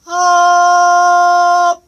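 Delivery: high, loud ringing ah; resonant ah. Shown as a demonstration. Coach demonstrating the right resonant ah. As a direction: ring the ah between the brows. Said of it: "この声です".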